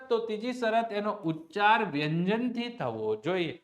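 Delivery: ordinary talk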